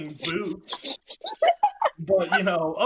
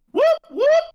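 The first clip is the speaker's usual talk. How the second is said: siren whoop; 11 dB louder than usual. Retold or imitated. Imitating police siren again.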